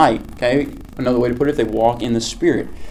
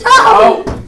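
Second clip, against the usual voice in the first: sudden high startled oh; a quick shocked cry; surprised yelp; 5 dB louder than usual. Real, not acted. Devil game, player bumps.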